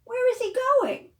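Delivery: high wondering question; curious wonder. Pretend. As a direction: ask the kids with big wonder. Storytime suspense question.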